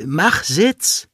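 high-pitched command to a dog. A narrator's reading. Owner holds a treat before the dog, commanding.

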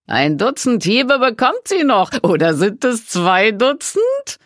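high, loud jeering voice; mocking. A narrator's reading. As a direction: sneer it out, slowing at the end. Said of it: "fragte jemand höhnisch".

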